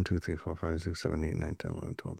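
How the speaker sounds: low-volume, even running count; tally them quietly to yourself; soft, level count, as if totting up privately; counting under breath. Real, not acted. Counting off topics to self.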